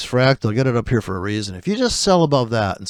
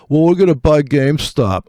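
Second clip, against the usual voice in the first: mocking a smug retail trader, a bit louder; mock trader boast; 3 semitones higher, 6 dB louder than usual. Trader mocking the GameStop crowd's smug boast. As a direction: parrot the goober army's smug line.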